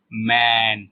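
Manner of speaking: 'मैन' slowly, stretching the open ai vowel; drawn-out vowel demo. Shown as a demonstration. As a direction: hold the ai in 'मैन'. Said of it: "ए का sound". ('मैन' में ऐ को खींचकर बोलें)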